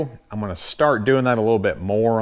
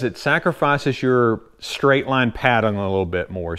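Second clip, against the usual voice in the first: usual speaking voice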